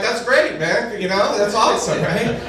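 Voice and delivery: high, over-accepting cheer; overeager support. Retold or imitated. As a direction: sound a bit too supportive. Acts supportive progressive friend.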